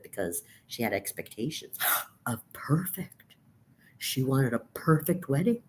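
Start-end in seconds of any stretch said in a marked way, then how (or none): 0.0-3.3 whisper. Acted. Mock-shocked aside.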